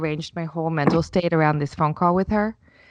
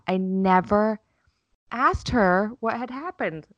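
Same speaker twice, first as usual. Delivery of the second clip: slow realisation; 3 semitones higher, slower than usual. Retold or imitated. Realisation retold slowly.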